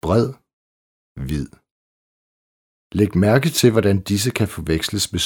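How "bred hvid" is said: Bred, hvid ending in a th-like soft D. Shown as a demonstration. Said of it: "Også i bøjede former af substantiver". (blødt D i Bred og hvid)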